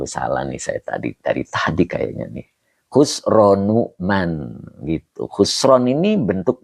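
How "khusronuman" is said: Khusronuman spelled out slowly, syllable by syllable; slow teaching demo of Khusronuman; drawn-out model reading of Khusronuman; correct reading. Shown as a demonstration. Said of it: "Jadi yang benar Khusronuman".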